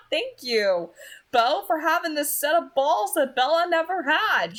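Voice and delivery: loud, high, laughing enthusiasm; excited aside. Real, not acted. Reader breaking off to cheer Beau, loud and laughing. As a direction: gush with delight and laughter.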